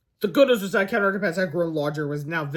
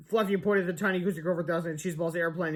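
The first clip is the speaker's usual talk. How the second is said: usual speaking voice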